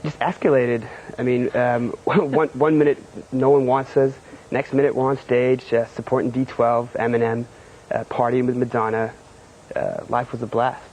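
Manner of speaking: Californian accent. Shown as a demonstration. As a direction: answer in character as a confident Californian. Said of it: "stick in your character and your Californian accent".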